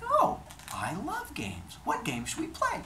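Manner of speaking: put-on high, girlish-eager wife voice; delighted wife. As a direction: pitch it up, playfully keen.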